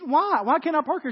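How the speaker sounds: high, loud mock protest; indignant questioning. Man challenging the woman over a parking rule, indignant. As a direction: challenge it in acted disbelief.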